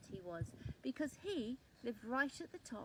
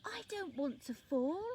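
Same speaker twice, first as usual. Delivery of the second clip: higher, scared plea; frightened; 5 semitones higher, 5 dB louder than usual. A narrator's reading. Top Leaf begging the tree not to fall, scared. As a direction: plead in fear. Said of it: "in a frightened voice".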